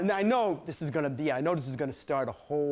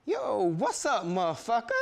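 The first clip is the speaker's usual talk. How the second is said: higher, mock tough taunt; gang taunt; 5 semitones higher than usual. Gang leader taunting the cornered narrator, higher mock voice. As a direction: swagger into the ambush. Gang ambushing him in hall.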